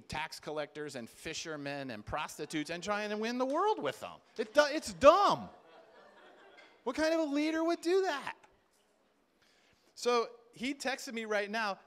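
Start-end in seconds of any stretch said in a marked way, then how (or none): 4.4-8.3 emphatic exclaim. Acted. Preacher's exclaimed point.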